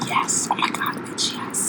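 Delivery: excited whisper. Acted. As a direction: whisper it eagerly. ASMR segment reply.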